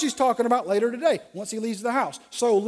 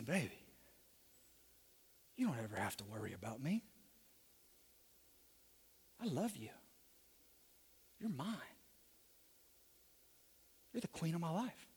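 deep, hushed sweet talk; 8 semitones lower, 21 dB quieter than usual. Acted. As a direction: murmur low, lover to his beloved.

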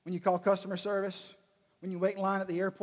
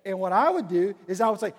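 usual speaking voice

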